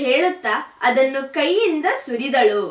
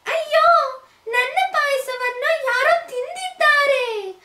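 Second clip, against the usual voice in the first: much higher, tearful cub voice; crying baby bear. A narrator's reading. Baby bear finds porridge eaten, tearful high wail. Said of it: "ಎಂದು ಕೂಗಿತು".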